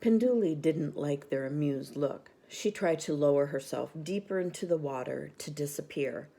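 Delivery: ordinary delivery, nothing marked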